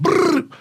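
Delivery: gun rattle. Retold or imitated. Soldier warning the crowd with the gun, imitated brrr. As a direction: growl a quick burst. Gun noise before shouting.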